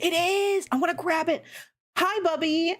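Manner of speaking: high excited squeals. Real, not acted. Squealing at attractive character.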